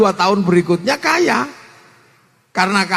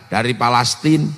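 calm Prophet; 10 semitones lower than usual. Acted. Rasul answers questioning companions.

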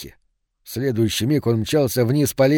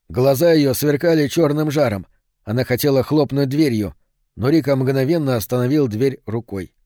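ordinary delivery

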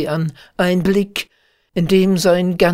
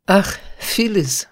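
mild impassioned exclamation, a little higher; calling out; 4 semitones higher, 3 dB louder than usual. A narrator's reading. Shepherd to his beloved, mildly impassioned cry. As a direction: call her name with feeling. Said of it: "rief er".

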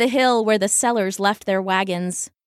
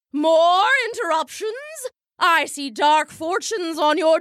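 higher, booming stage prophet; stage bellow; 5 semitones higher than usual. Acted. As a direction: bellow doom over the boy. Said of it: "her trained stage bellows".

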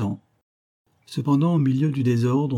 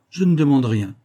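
higher, louder declaration; raised-voice refusal; firm intervention; 4 semitones higher, 5 dB louder than usual. A narrator's reading. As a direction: step in and refuse firmly. Colonel intervenes in dispute.

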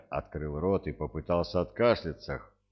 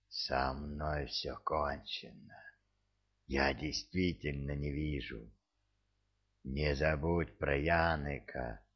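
dying man, faint; 3 semitones lower, 5 dB quieter than usual. A narrator's reading. Dying prisoner's farewell.

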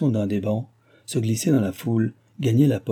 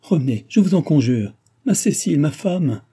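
louder, rising urgent entreaty; vehement pleading; 5 semitones higher, 5 dB louder than usual. A narrator's reading. Delville begging his bride to come back, crying out vehemently. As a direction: beg her back, climbing high on her name. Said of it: "s'écria Delville avec vivacité".

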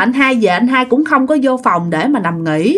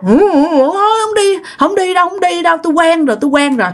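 imitating a crying wife; 7 semitones higher than usual. Beaten wife refusing the hospital, imitated tearful wail. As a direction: whimper it like a woman who has given up. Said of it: "mà ngồi mà khóc".

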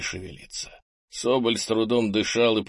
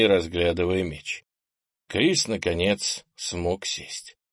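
ordinary delivery

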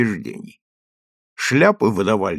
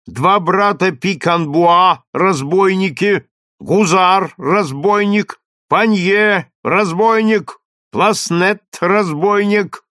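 slow, loud and higher, like a trumpet; booming crier; 6 semitones higher, 4 dB louder, slower than usual. A narrator's reading. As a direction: boom each word out like a trumpet. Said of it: "читал глашатый".

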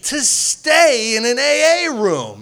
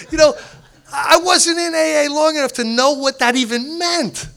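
ordinary delivery

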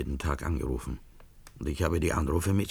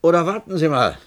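loud, high call after someone leaving; calling him back; 12 semitones higher, 11 dB louder than usual. Acted. Bar owner calling the leaving guest back, loud and high. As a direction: shout him back before he's gone.

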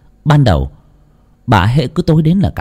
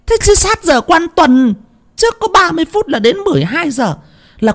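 much higher, scolding, rising to a tearful shout; scolding howl. A narrator's reading. Fortune-teller scolds Thắm over the burial hour, high and alarmed. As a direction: berate her, voice climbing in alarm. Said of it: "thầy choáng váng hú lên một cái".

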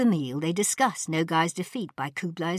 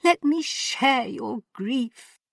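high, slow, grand motherly cry; Empress crying out; 8 semitones higher, 4 dB louder, slower than usual. Acted. The Empress calls Ping Cho to her, high and slow. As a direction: open your arms wide in sympathy. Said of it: "cried the Empress".

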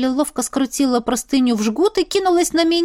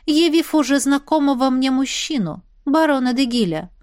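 usual speaking voice

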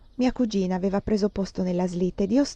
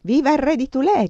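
cheering shout; 5 semitones higher, 5 dB louder than usual. Acted. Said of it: "gridò qualcuno".

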